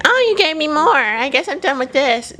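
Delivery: high-pitched imitation of a toddler; voiced toddler. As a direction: put on a high little-kid voice. Voicing the child's reaction.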